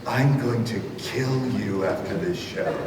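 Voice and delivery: low, slow, very soft menace; whispered threat. Retold or imitated. Gym teacher to performer while walking down front, hushed threat. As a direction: lean in and murmur it like a threat, slowly. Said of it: "he whispered, he leans over to me".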